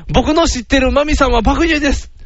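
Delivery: comic high-pitched insistence; insistent suitor. Acted. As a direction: play the boyfriend politely contradicting her father. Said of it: "お父さん".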